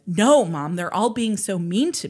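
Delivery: usual speaking voice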